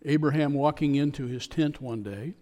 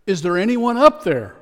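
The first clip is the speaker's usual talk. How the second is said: loud, high, panicked call upward; terrified shout; 6 semitones higher, 9 dB louder than usual. Retold or imitated. Tourist dangling over the canyon, terrified shout. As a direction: cry out in terror from the cliff. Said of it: "Filled with terror".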